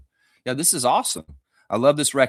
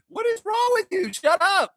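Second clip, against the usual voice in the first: much higher, shouted disbelief; exasperated outburst. Pretend. Scolding negative fans, high mock exasperated shout. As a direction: yell at them in mock outrage.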